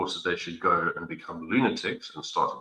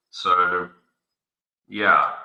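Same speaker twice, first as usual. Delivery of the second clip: usual speaking voice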